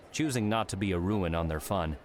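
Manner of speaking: ordinary delivery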